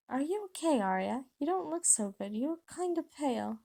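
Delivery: high, concerned schoolgirl voice; concerned girl. Acted. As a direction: pitch it up and check on a friend. Ginny asks after Arya's health.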